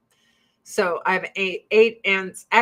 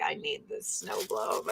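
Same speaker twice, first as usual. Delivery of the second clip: whispered, hushed longing; covetous whisper; 5 semitones lower, 11 dB quieter than usual. Retold or imitated. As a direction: breathe it like a secret craving. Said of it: "And I was like".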